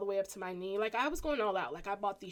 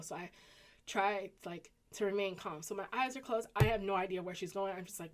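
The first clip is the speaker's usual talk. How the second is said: ordinary talk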